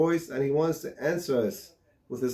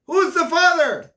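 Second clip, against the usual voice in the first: emphatic; 7 dB louder, slower than usual. Acted. Rhetorical punchline in parable.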